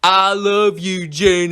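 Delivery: slow, drawn-out Southern simpleton; mock a dopey, lovestruck Southern drawl; badly acted declaration of love, stretched out; Forrest Gump. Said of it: "he sounds like Forrest fucking Gump".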